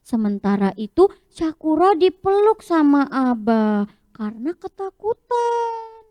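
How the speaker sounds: ordinary talk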